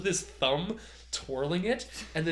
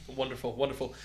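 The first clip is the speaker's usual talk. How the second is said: ordinary talk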